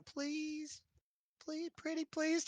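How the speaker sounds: soft, high begging; cutesy little plea; pleading. Acted. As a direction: wheedle sweetly with fox-eyed innocence. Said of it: "She gives you big, giant fox eyes".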